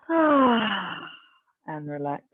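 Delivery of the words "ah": releasing exhale sigh. Acted. Exhale cue in class.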